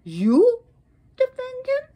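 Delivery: loud YOU, then shooting up high; incredulous, high-pitched challenge; outraged disbelief. Acted. Sarasvati rounds on Shiva for speaking up, outraged disbelief. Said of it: "Then Shiva spoke".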